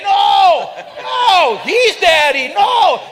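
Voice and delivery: high, loud frantic denial; panicked shout. Retold or imitated. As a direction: yell it in alarm, pointing at the big guy. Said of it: "I'm saying".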